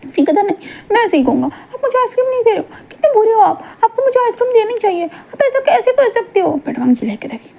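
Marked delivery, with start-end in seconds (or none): 0.4-6.6 high, half-laughing tantrum voice; whiny childish Wing. Acted. Wing whining at Wangji for ice cream, high childish tantrum. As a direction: stamp your foot like a spoilt child.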